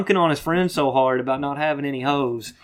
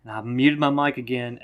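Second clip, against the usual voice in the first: ordinary delivery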